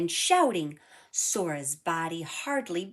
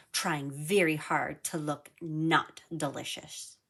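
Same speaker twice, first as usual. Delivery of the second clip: usual speaking voice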